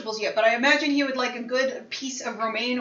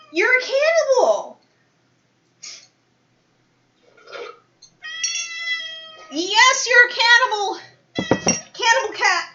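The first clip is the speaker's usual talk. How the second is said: playful shout; 13 semitones higher, 6 dB louder than usual. Real, not acted. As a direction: holler it at the cat, delighted.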